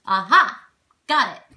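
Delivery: loud chest-voice exclamation of discovery; triumphant. Shown as a demonstration. Just found someone who was hiding, loud triumph. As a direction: say it like you really got them. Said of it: "Like you really got them".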